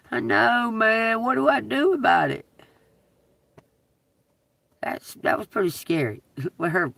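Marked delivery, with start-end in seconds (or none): none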